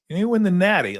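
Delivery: high mock voice of an old guy being hip; lightly voiced mock old man. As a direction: parody someone trying too hard. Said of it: "old guys like me say natty".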